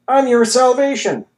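high, loud announcement of salvation; fervent proclamation. A narrator's reading. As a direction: grab him and proclaim. Grabs orderly, proclaims.

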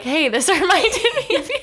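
high, laughing mock text message; laughing mimicry of a sweet text; mock sweet quote with laugh. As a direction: tease the line through giggles.

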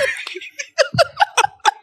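high, bursting laugh; burst of laughter. Real, not acted. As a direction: break up laughing. Laughs after the mimicry.

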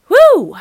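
a high, loud whoop of self-cheer; excited woo. Real, not acted. Pumped-up whoop after bragging about the workout. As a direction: whoop it out, proud of yourself.

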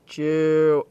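low, drawn-out mock heckle; imitated heckler call. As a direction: imitate a drawn-out call that could pass for "boo". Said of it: "you would hear him go".

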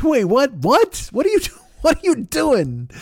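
high, incredulous double take; wait, what? rising in disbelief; baffled disbelief. Retold or imitated. Watching the speech on TV, stunned at the name-drop, high and incredulous. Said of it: "And I'm like".

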